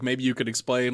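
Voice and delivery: usual speaking voice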